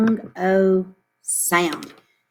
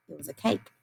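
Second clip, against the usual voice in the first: It was a cake repeated much softer; 4 semitones lower, 10 dB quieter, faster than usual. Shown as a demonstration. Teacher softly repeats the answer cake to the class. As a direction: echo It was a cake gently, under the breath. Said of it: "do you remember what our picture card was".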